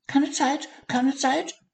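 very high squeaky hurried mouse; high squeaky hazel mouse in a hurry; squeaking mouse. A narrator's reading. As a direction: squeak it while rushing past. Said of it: "fiepte die kleine Maus".

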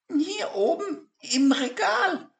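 very high toy voice with a giggle; high penguin voice, laughing a little; tiny voice. Acted. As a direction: answer playfully from the shelf. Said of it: "antwortete die Stimme".

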